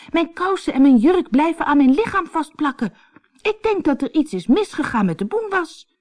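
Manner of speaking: put-on very high girl's voice, upset; distressed cry. A narrator's reading. Anneke explains to mother she is stuck, high distressed cry. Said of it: "riep Anneke".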